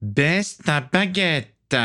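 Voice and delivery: ordre menaçant, très lent, voix haute; étire chaque mot en sifflant de colère; ordre sifflé. A narrator's reading. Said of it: "siffla Stradus". (vampire qui siffle son ordre, syllabes très étirées)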